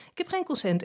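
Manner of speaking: high-pitched imitation of gleeful calling; just as happy. Actresses cheerfully accepting a no, happy mock calling. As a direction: cheerily announce it, as if good news. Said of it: "roepen ze net zo blij".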